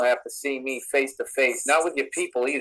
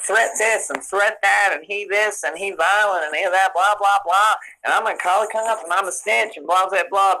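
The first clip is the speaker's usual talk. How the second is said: high, louder mocking whine; mock whining; 7 semitones higher, 4 dB louder than usual. Streamer mimicking a rival whining about threats, mock whiny. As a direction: imitate a whiner's endless complaints, pitched up. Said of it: "Always whining about".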